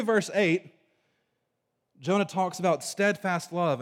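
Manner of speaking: usual speaking voice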